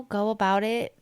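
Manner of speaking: ordinary delivery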